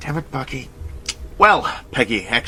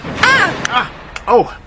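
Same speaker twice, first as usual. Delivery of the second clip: sudden high startled cries; startled yelps; 5 dB louder, much slower than usual. Acted. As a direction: jump at the collision, yelping. Bumping into Peggy.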